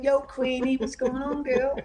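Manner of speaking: high-pitched, jokey over-familiar hail; mock chummy greeting. Joking about phoning the Queen, mock chummy greeting. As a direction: pretend to greet royalty like an old pal.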